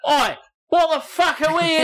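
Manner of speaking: loud, high-pitched Cockney outburst; Cockney accent. Acted. Alien landing on Earth, indignant Cockney shout. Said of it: "came down and had a Cockney accent".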